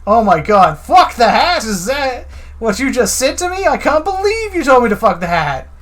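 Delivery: loud, high, hammed-up indignation; shouting like a scandalized cop; mock indignant outrage. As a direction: blow up in fake outrage over the hat. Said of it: "Feel it way up".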